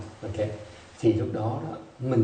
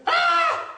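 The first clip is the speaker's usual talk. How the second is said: high, sharp imitated yelp of a struck boy; boy's scream; 5 dB louder than usual. Monk imitating the boy hit with the stick, sudden high yelp. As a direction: let out the boy's shocked cry. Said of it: "Nó hét một cái xong nó khóc".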